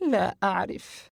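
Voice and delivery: unsure, a mild hesitant admission; confused. Acted. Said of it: "عائشة بحيرة شديدة".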